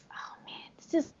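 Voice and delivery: unvoiced mock whisper; hushed imitation of a horror fan. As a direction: breathe it like a scared moviegoer. Mocking horror movie characters.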